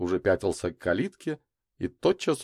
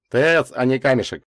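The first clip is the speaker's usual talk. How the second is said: higher, louder quip; wisecracking; 6 semitones higher, 6 dB louder than usual. A narrator's reading. Славка jokes about the meteorite's temperature, wisecracking. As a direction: toss in a joke at the numbers. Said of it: "сострил Славка".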